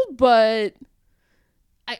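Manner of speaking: ordinary talk, nothing marked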